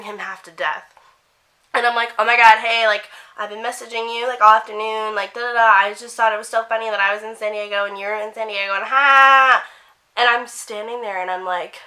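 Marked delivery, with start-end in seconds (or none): 2.2-9.6 loud, breathless-to-impress fan babble; imitated gushing fangirl. Re-enacting a fan rushing up to a YouTuber, gushing and overexcited. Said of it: "so I was that girl".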